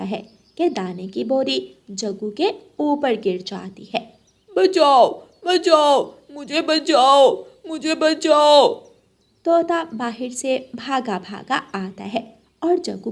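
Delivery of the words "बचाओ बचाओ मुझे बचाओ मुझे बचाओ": loud, higher frantic calls, slower at end; cry for help. Acted. Jaggu trapped under the grain sack cries loudly for help.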